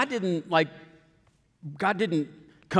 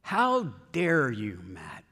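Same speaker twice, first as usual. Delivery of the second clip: self-rebuke; 4 semitones lower, much slower than usual. Retold or imitated. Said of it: "I heard those words".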